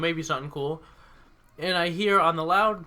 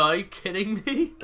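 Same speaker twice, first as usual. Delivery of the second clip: ordinary talk, nothing marked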